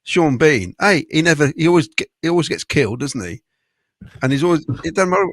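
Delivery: usual speaking voice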